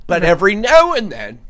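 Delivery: ordinary talk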